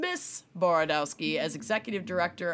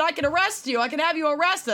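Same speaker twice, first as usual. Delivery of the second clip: high-pitched mild mock scream; 7 semitones higher, faster than usual. Man out of his car threatening a driver, mild mock scream. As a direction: make an indignant threat in a raised, put-on voice. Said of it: "and he's screaming".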